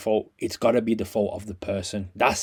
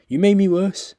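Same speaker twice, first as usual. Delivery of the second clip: higher, louder outburst; loud angry repeat; 8 semitones higher, 7 dB louder than usual. Real, not acted. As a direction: shout it back the second time.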